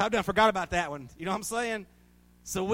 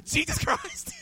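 Jesus Christ thrown out much higher and louder; yelled out; 4 dB louder, slower than usual. Retold or imitated. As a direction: shout the name back like an eager amen. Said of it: "I'd yell out".

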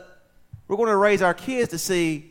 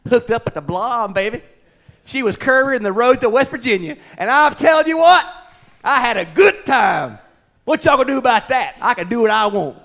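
mock swaggering boast, building to shouts; shameless braggart; 3 semitones higher, 9 dB louder than usual. Pastor pretending to boast of Saturday night, mock swagger. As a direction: brag loudly like a proud sinner. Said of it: "I don't get up here on Sunday mornings".

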